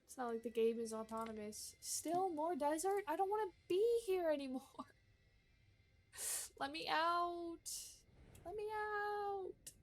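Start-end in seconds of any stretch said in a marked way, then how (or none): 1.8-7.7 mock despair. Mock despair at endless desert. 8.4-9.7 much higher, slow, stretched Let me out; mock wail. Mock despair at endless desert.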